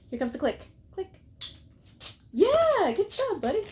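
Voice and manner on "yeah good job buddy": much higher, louder cheer; dog praise. Pretend. Praising dog after click.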